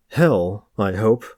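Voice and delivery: usual speaking voice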